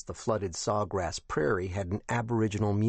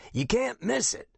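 put-on high, scratchy old captain. A narrator's reading. Retired captain gives directions by phone, scratchy high voice. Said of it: "in a voice that sounded even scratchier".

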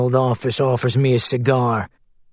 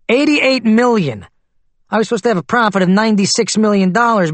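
angry rant; 7 semitones higher than usual. Acted. Witness ranting at the lawyer about his money, angry. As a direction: vent the outrage over the lost millions.

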